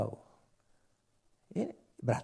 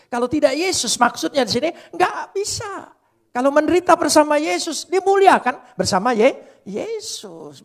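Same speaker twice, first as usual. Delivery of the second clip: full force preaching; 13 dB louder than usual. Acted.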